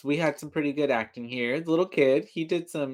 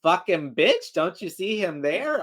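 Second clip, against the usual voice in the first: imitating an indignant kid, higher voice; mock exasperation; 4 semitones higher, 4 dB louder than usual. Kid telling mom Chucky is right there, mock exasperated. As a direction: spell it out as if she's an idiot. Voicing the kid's exasperated subtext.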